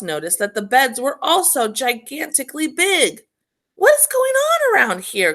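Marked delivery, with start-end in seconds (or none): 3.8-5.3 much higher, louder tired complaint; a worn-out little girl groaning; exhausted groan. A narrator's reading. Exhausted Goldilocks complaining about the huge beds, groaning. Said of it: "groaned the exhausted girl".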